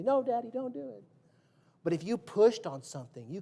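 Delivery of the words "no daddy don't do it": put-on childish plea.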